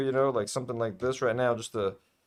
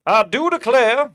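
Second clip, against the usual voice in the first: slow, higher, louder Southern belle-style drawl; a hammed-up Southern gentleman exclaiming; mock Southern accent under the big hat; 8 semitones higher, 8 dB louder, slower than usual. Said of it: "I'm giving him this accent".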